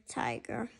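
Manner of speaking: whispered a tiger. Acted. Tig warning Lily in a hushed whisper. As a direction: drop to a secret whisper. Tig's embarrassed aside.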